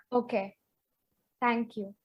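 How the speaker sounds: dropping to a small, defeated voice; deflated, quiet thanks. Retold or imitated. Girl thanking senior girls, quietly embarrassed. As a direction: murmur it as if shrinking away. Embarrassed after being told about stain.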